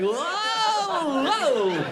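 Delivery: high, drawn-out stupefied "whoa"; dumbfounded. Acted. Comic imitates getting dumber beside someone dumb, stupefied wail. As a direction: wail it like your brain is failing. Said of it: "so dumb that you get dumber".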